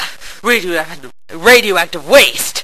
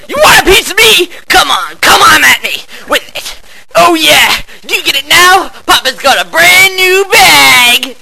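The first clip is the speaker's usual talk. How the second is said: taunting shout; 8 semitones higher, 5 dB louder than usual. Acted. Taunting enemies loudly.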